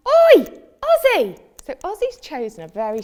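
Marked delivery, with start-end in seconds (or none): 0.1-1.4 loud sharp call across the garden; loud shout. Retold or imitated. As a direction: yell his name to stop him. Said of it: "I shouted".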